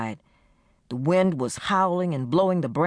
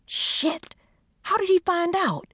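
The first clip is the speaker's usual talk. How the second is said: alarmed curse; slower than usual. Acted. Friend reacting in alarm.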